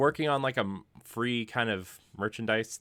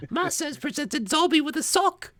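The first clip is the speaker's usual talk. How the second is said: much higher, squeaky put-on British elf voice; terrible British accent; 3 dB louder than usual. Host jokingly showing his bad British accent, squeaky. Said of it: "that's enough of my terrible accents".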